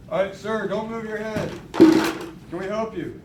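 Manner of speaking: paramedic. Acted. Student acts paramedic greeting patient.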